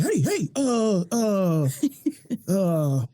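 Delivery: mock coach calling out before knowing what to say; stalling coach impression. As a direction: bark "hey", then trail into slow filler grunts. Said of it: "coaches say stuff before they even know what".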